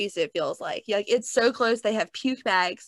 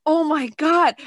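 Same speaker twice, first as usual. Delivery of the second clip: higher, louder retold alarm; mock panic; 5 semitones higher, 5 dB louder than usual. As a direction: imitate a friend starting to panic. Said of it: "Brian looks at me panicking".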